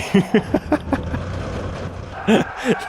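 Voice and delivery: long unvoiced wheezing laugh; breathless laugh. Real, not acted. Streamer laughs at another disconcerting camera effect.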